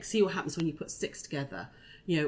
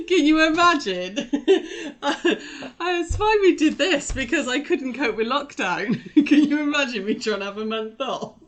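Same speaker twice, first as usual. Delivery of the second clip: laughing; 7 semitones higher, 7 dB louder than usual. Real, not acted. Laughing at month-off idea.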